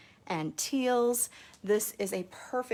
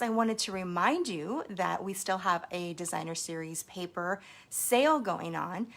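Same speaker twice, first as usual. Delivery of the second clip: usual speaking voice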